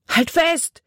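high, pushed call. A narrator's reading. Boy holding out a branch calls to the girl, urgent. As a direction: urge her to hold on. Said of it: "rief er".

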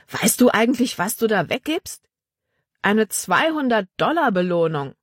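a bandit who can't believe his ears; incredulous bandit. A narrator's reading. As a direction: bandit scolding his partner, disbelieving. Bandit voiced by narrator.

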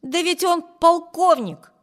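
loud, high-pitched insistence; exclaiming. A narrator's reading. Vibert vouches for the colonel to Amy, exclaiming. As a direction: protest it as if it settles everything. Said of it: "воскликнул Виберт".

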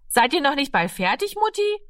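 put-on high child's voice calling to Mum; impatient high call through a door. A narrator's reading. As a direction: call in high, rising at the end. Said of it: "rief es von der Tür".

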